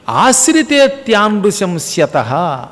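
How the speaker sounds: slow, chanted Sanskrit recital of the verse phrase; Sanskrit recitation. Shown as a demonstration. Preacher reciting a Sanskrit phrase from Ramayana, slow chant. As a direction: intone the scripture slowly. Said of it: "Sanskrit phrase quoted in discourse".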